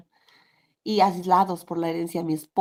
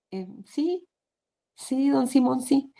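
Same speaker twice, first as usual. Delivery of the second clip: put-on boy's meek reply; 6 semitones higher, slower than usual. Boy answers timidly.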